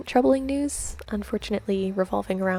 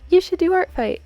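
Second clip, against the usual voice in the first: bright, loud, higher cheerful push; mock cheerful; 9 semitones higher, 8 dB louder than usual. Vlogger mimicking her past self urging viewers, cheerful. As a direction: hype it up like an eager recommender. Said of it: "in the other video I'm like".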